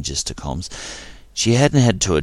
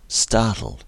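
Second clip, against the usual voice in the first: ordinary delivery, nothing marked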